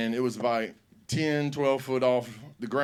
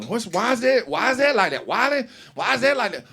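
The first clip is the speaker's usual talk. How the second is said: put-on high, eager kid asking why; curious kid; 8 semitones higher, 3 dB louder than usual. Kid in the back seat pestering dad, high and eager. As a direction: pester with endless questions.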